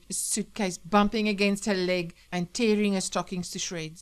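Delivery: ordinary delivery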